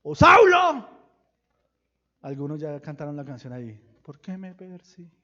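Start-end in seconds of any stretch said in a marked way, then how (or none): 0.0-0.9 much higher, much louder call. Pretend. God calling out to Saul on the road. 4.0-5.1 inner voice. Pretend. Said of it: "una voz interior que le dijo".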